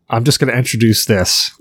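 normal talking voice

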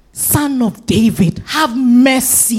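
slow, drawn-out plea; crying out. Retold or imitated. Blind man begging Jesus for mercy, drawn-out crying out. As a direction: stretch each word into a long imploring call. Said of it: "the man kept crying out".

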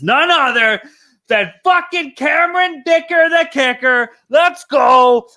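hype shout. Real, not acted. Fan to co-hosts, hype shout for kicker. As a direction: shout his hero's name like a stadium cheer. Hyping his favourite kicker.

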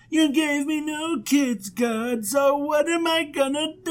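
hammed-up plea to God; mock-sad complaint. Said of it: "And he's so sad".

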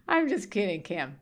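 high-pitched, through laughter; laughing. Real, not acted. Mother taking back a tease, laughing. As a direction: say it while cracking up. Laughing after teasing her son.

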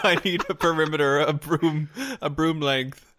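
laughing. Real, not acted. Laughing excited talk.

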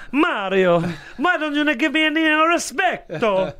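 high-pitched mock Italian accent; hammed-up, plaintive Italian cartoon voice; Luigi impression. Host playing Luigi complaining to Mario, high-pitched Italian voice. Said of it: "high-pitched Italian voice".

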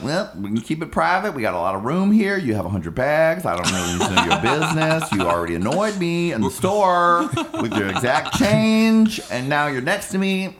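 pointed, sassy telling-off; sassy retort. Retold or imitated. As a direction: needle her with sarcasm. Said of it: "And I'm just like".

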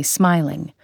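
usual speaking voice

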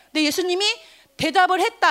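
ordinary delivery

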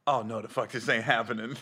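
low, soft dread; hushed dread. Retold or imitated. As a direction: mutter it frozen in fear. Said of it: "I went".

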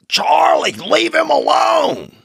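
very high slow mock yell; old man yelling at dog. Old man in the park scolding his dog, high slow yell. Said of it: "And this man's".

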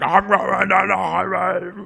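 slow, growled-out monster line; lightly voiced monster; a hint of cartoon monster. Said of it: "they're like monsters".

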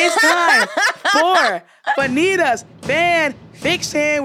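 high, laughing segment intro; show announcer. Pretend. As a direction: announce it big, stretching the title out. Host announces the show's segment.

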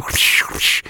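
whooshing whip-crack noises made by mouth; mimed self-flogging swishes; imitated whip lashes. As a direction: make swishing lash sounds.

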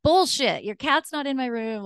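high indignant call-out; retold yell. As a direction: snap back at a lame excuse. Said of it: "I was just yelling at him".

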